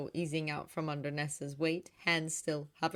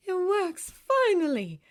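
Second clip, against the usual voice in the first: high, louder exclamation of relief; elated relief; 13 semitones higher, 8 dB louder, slower than usual. A narrator's reading. Sister rejoicing that her spell succeeded, elated relief. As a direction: let the relief burst out. Same words, now openly rejoicing.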